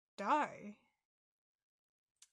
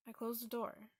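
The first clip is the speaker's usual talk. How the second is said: usual speaking voice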